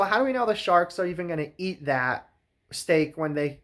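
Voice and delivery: ordinary delivery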